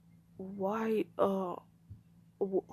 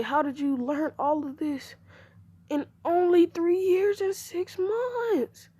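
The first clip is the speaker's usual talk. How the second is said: high, drawn-out disbelieving question; astonished; 9 semitones higher, slower than usual. Acted. Stunned dad questions his son's fast learning, astonished. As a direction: ask it in rising amazement, stretching the end.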